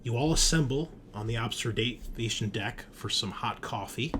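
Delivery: usual speaking voice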